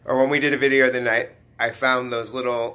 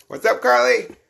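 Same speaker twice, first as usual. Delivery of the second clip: high, louder hello to a viewer; excited greeting; 11 semitones higher, 7 dB louder than usual. Real, not acted. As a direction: call out a bright hi. Greeting live chat viewer.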